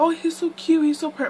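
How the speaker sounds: high-pitched cooing over a newborn; imitated gushing. Imitates gushing sister and family.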